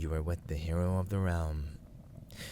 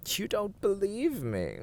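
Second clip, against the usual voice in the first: ordinary delivery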